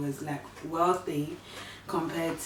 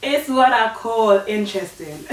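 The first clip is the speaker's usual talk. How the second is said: laughing; 9 semitones higher, 7 dB louder than usual. Real, not acted. Laughing about boarding school.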